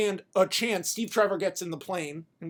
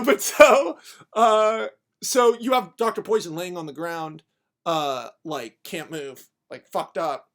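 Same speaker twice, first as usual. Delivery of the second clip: usual speaking voice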